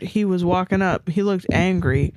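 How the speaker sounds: usual speaking voice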